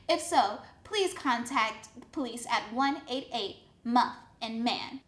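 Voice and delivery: ordinary delivery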